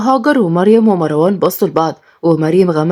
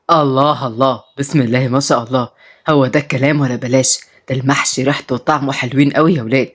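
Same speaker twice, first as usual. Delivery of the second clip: put-on low-pitched, appreciative old man; pleased grandfather; 5 semitones lower than usual. Grandfather praising the stuffed vegetables, pleased and low. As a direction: savour it in a low voice.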